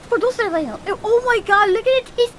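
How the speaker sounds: ordinary delivery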